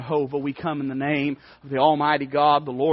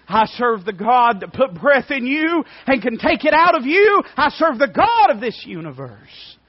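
higher and louder, rising to a peak; preaching at full force; 10 semitones higher, 10 dB louder than usual. Acted. Preacher voicing David's faith to Goliath, shouting. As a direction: ring it out over the crowd.

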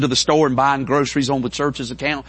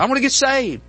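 pitched way up, urgent and eager; high excited insistence; 12 semitones higher, 4 dB louder than usual. Retold or imitated. Kenny repeating at the altar, urgent and high. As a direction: insist it again, much higher.

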